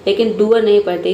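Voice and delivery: usual speaking voice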